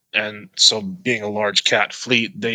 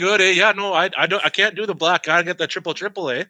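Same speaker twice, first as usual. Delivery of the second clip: higher pitched hammed-up Canadian accent; 7 semitones higher than usual. Joking with his buddy in a higher, hammed-up Canadian accent. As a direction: play a chirpy Canadian at Tim Hortons, voice pitched up. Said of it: "talking to a Canadian's real easy".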